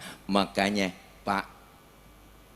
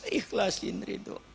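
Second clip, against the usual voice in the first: tearful plea; 11 semitones higher, slower than usual. Retold or imitated. Child begging mother's forgiveness.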